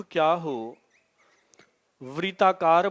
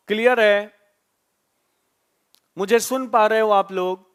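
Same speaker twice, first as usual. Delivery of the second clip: louder and higher, checking in with live viewers; raised voice asking the live audience; calling out to viewers; 4 semitones higher, 6 dB louder than usual. Real, not acted. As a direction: call out to the chat to make sure they hear.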